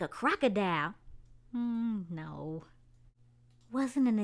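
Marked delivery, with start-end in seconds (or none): none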